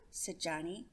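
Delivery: plain reading voice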